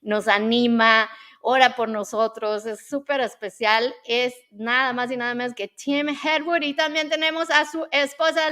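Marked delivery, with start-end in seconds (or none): none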